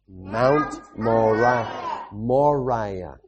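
Mount Moriah stretched very slowly, each syllable long; drawn out. Shown as a demonstration. Preacher leading children through the name, very slow. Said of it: "Can you say it with me?".

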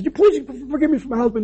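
high-pitched pleading imitation; apologetic wife. Wife apologizing to the pastor for her husband, high pleading. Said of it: "she comes up, she goes".

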